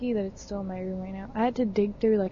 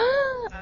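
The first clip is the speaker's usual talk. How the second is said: mock shocked gasp; 14 semitones higher than usual. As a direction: gasp like a scandalized little kid. Mock shock before tattling.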